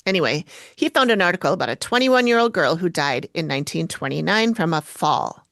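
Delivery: ordinary delivery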